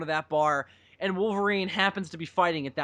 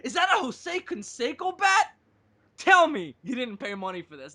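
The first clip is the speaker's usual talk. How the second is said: cartoon character quote; 6 semitones higher than usual. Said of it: "quoting a movie character".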